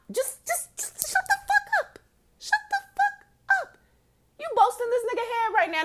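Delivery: very high strained squeal; high-pitched mock outburst; exasperated squeal. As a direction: plead in a squeaky, fed-up voice. Mock-yelling at her own body.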